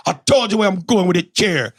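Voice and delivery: high, exasperated fake Jamaican; hammed-up Jamaican comeback; mock Jamaican accent. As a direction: throw her Jamaican accent back at her. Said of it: "I started doing my Jamaican accent".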